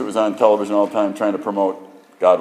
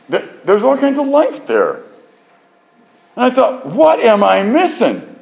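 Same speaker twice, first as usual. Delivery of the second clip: astonished; 14 semitones higher, 6 dB louder than usual. Retold or imitated. Recalling astonishment at microscope.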